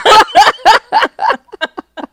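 a loud, high burst of laughter. Real, not acted. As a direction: crack up loudly at the joke. Laughing at friend's joke.

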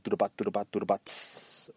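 soft vocal drum beat, spoken low; beatbox-style drum line, murmured; mouth drumming. Shown as a demonstration. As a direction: tap out the drum line with your voice. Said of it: "speaking your drum lines".

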